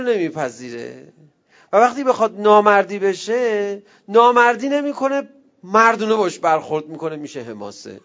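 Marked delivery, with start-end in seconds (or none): 1.7-7.2 louder, higher preaching voice building up; forceful preaching. Acted. Preacher rousing the congregation, louder and higher. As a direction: drive the point home to the crowd.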